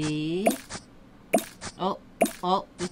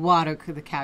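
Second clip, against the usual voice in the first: ordinary talk, nothing marked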